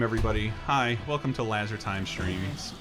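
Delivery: ordinary talk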